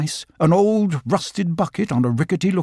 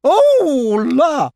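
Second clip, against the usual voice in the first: much higher, louder prissy exclamation; dainty otter cry; 12 semitones higher, 6 dB louder than usual. Acted. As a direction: cry out like a fussy little lady otter. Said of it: "cried Lottie".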